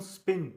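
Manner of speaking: स्पिन with only a weak puff of air on the P. Shown as a demonstration. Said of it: "वीक puff पड़ रहा है".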